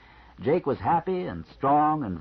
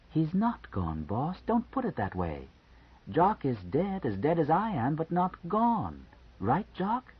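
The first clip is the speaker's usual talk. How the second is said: Eunice gently insisting; 5 semitones higher than usual. A narrator's reading. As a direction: coax it, lingering on gone. Eunice answers the boss, softly insistent.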